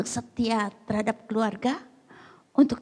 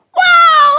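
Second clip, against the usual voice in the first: very high, loud exclamation; shocked outburst; 22 dB louder than usual. Acted. Wife hearing she must abandon her faith, high shocked yell. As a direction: explode in shock.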